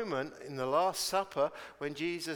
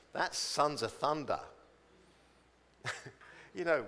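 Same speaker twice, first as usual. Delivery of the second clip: normal talking voice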